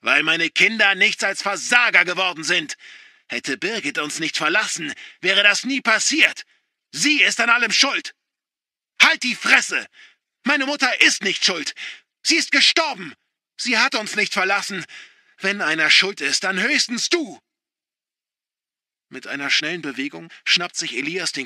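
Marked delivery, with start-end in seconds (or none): 0.0-8.1 high, loud bitter yelling; furious shout. A narrator's reading. Father shouting blame at his son. 9.0-17.4 much higher, very loud yell; enraged scream. A narrator's reading. Son screaming at father about his mother.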